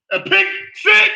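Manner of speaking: a pick six? jumps high and loud in disbelief; real shock, voice leaping up; shocked outcry. Real, not acted. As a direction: yelp of disbelief at the play. Reacting to an interception live.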